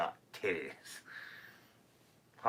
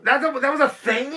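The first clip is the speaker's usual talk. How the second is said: high, loud disbelief; shocked; 14 dB louder than usual. Real, not acted. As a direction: squeal in disbelief.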